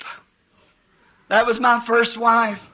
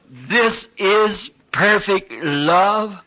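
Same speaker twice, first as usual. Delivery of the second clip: slow, lower, weighty pronouncement; solemn heavenly voice; 4 semitones lower, much slower than usual. Retold or imitated. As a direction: let each word land slowly like a voice from above. Heavenly voice speaks in vision.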